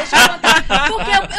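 loud squealing shout; laughing shout. Real, not acted. Wife shouts over the host to be let to tell, laughing. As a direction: yell it through laughter.

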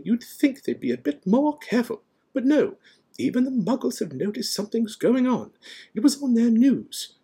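high, loud, indignant complaint; impatient. A narrator's reading. McGonagall complaining about careless wizards, impatient. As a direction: complain with rising exasperation. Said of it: "she said impatiently".

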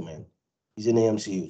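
normal talking voice

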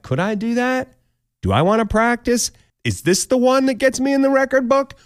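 high, eager questions to yourself; eager hopeful listener. Retold or imitated. Listener imagining breaking the kiss record, high and eager. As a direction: sound keen and hopeful. If you're like.

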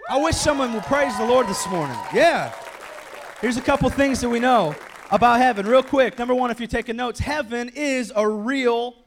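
loud, driving sermon shout; rally the congregation loudly; preaching at full force. Acted.

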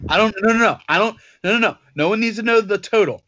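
normal talking voice

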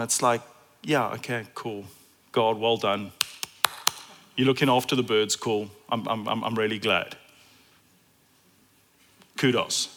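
low, soft, mildly sarcastic shrug; a touch of sarcastic unimpressed listener. Retold or imitated. Preacher mimicking unimpressed crowd, low and soft. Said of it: "And they might have gone".

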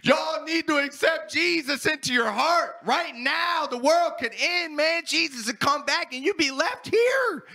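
high, loud street-preacher call; preaching at the crew. Retold or imitated. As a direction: warn them all at full zeal. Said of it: "I was like".

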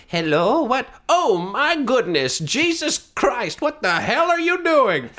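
high, loud, slow outraged nun; shocked nun. Acted. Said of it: "she's like".

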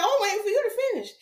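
high, quick, sing-song reply; faux-sweet high-pitched answer, rattled off quickly; mock innocence. Repeats feigned innocent answer to client.

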